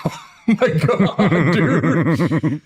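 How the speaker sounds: slow, high, laughing oh my God; barely holding in laughter. Retold or imitated. Plebe seeing roommate at attention, laughing. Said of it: "I'm like, laughs".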